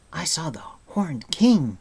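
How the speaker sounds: high, loud, agitated outburst; bursting out. A narrator's reading. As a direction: blurt out alarming news. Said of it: "Taran burst out".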